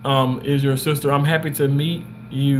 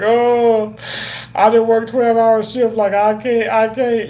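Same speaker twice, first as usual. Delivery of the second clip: high, loud exhausted complaining; mocking himself as too tired after a twelve-hour shift; mock tired whine; 7 semitones higher, 4 dB louder than usual. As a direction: play yourself worn out after a shift. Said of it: "I'm sitting here like".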